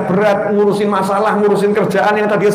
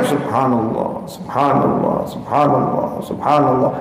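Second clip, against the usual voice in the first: soft, low, drawn-out Subhanallah, felt deeply; heartfelt dhikr; 9 semitones lower, 5 dB quieter, slower than usual. Shown as a demonstration. Preacher shows how the companions recited, soft and heartfelt. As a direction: breathe each Subhanallah slowly from the heart. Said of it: "Dihayati betul".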